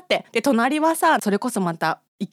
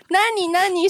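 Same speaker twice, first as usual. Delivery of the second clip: lightly imitated high pleading what-what; cutesy puppy-girl voice; 8 semitones higher than usual. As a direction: mimic a clingy girl's sweet question. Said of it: "こういう声で".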